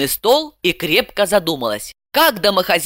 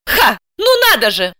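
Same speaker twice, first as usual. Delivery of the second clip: higher-pitched scoffing exclamation; indignant; 6 semitones higher, 4 dB louder than usual. A narrator's reading. Housewife hearing of a critic, indignant. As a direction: scoff with outrage. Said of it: "возмутилась она".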